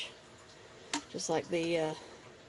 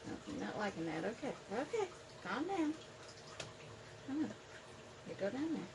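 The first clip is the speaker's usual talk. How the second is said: soothing; 13 dB quieter than usual. Real, not acted. Coaxing spider into new hide.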